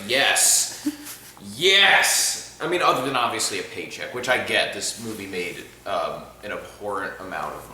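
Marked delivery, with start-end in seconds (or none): 0.1-2.6 mock-eager yes, jumping high at the second yes. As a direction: play the too-keen job taker, yes then a squealed yes. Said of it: "who takes that job and is like".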